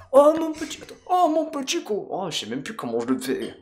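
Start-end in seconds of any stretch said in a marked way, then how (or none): 0.1-2.3 high mock southern French accent; Eve, southern accent. Krogan woman to her darling, high southern French accent. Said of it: "un petit accent du sud".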